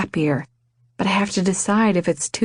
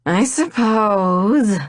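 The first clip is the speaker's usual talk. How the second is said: drawn-out, grudging reluctant agreement, slow; exasperated; 4 semitones higher, much slower than usual. Acted. Groom grudgingly agreeing to the surprise proposal, exasperated. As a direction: sigh the words out like it's a chore. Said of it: "He lets out a clearly exasperated sigh".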